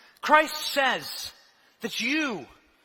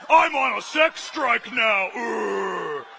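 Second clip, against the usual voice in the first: slow, lightly voiced grumbling uncle; a hint of a sulking old man; Clarence Thomas; 3 semitones lower, much slower than usual. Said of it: "Uncle Clarence".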